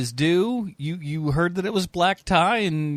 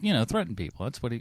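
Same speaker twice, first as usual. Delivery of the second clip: normal talking voice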